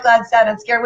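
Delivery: normal talking voice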